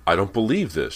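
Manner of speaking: loud, high outcry; crying out. A narrator's reading. Girl finding someone was in her room, crying out. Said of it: "she cried out loud".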